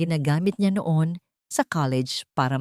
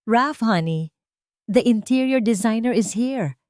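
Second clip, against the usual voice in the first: mild cheery call, higher; a light, lively call up to honey, higher; cheerful call; 5 semitones higher than usual. A narrator's reading. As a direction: sing out sweetly to her husband. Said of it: "Masiglang sigaw nito".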